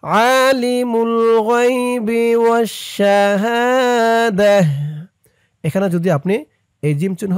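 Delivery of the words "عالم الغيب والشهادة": slow, chanted tajweed recitation; Quran recitation. Shown as a demonstration. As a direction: recite it melodically for students to follow. Teacher recites verse again.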